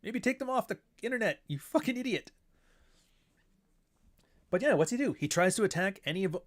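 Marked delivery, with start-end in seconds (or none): 0.0-2.3 high, chirpy scolding little-conscience voice; squeaky mock advice ending in an insult; Jiminy Cricket conscience. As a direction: pipe up like a tiny nagging cricket. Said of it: "I'm here as, like, Jiminy Cricket".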